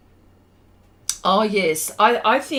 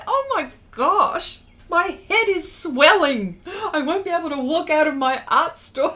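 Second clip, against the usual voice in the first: flattered delight; 7 semitones higher, 4 dB louder than usual. Real, not acted. Flattered by a viewer's compliment.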